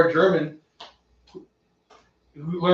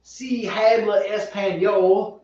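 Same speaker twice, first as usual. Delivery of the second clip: slow, higher gringo Spanish; mock American-accented Spanish; 4 semitones higher, much slower than usual. Co-host joining in on the tech line joke, American-accented Spanish. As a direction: imitate a badly accented phone prompt. Mocking the bad recorded Spanish.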